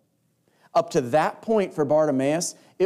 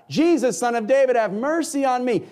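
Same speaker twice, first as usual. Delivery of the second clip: desperate cry; 9 semitones higher than usual. Acted. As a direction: beg him at the top of your voice. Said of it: "he cried out".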